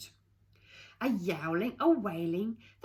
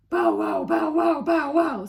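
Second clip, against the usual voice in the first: loud shouted bow wows; barking dog; 8 semitones higher, 11 dB louder than usual. Acted. Blitzer Maloney the dog barks, loud. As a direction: bark loud like a hound. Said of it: "said Blitzer Maloney".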